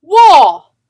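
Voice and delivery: high, loud yell of "Wall!"; top-of-lungs shout. A narrator's reading. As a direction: shout with everything you've got. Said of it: "shouted at the top of her lungs".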